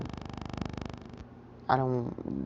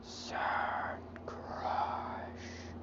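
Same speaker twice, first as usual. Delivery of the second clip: breathless whispered voice in his head; ghostly whisper; 11 dB quieter than usual. Acted. Inner voice speaking to toddler Midoriya, eerie whisper. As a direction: whisper it like a voice from nowhere. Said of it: "hears a voice in his head say".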